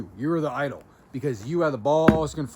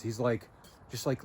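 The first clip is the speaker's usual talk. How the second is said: ordinary talk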